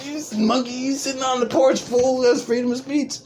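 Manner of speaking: high, mocking comeback; high-pitched mock retort. Acted name-caller defending himself to the offended man, high mock retort. Acts out taunting comeback in quote.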